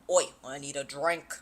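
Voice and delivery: low put-on Australian accent; Tynan. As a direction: do a rough Australian impression. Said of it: "He's from Australia, so excuse our accents".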